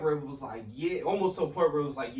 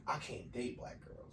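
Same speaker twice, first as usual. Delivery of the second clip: mocking murmur; 3 semitones lower, 14 dB quieter than usual.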